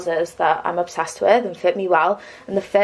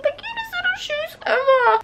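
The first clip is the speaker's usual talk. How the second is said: excited squeal. Real, not acted. Gushing over new shoes.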